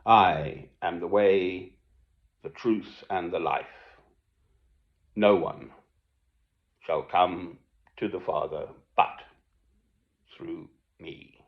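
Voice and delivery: slow, reverent Bible quote fading to a whisper; slow reverent reading of John fourteen six; solemn scripture reading. Acted. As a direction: read it like holy scripture. Quoting Christ's words solemnly.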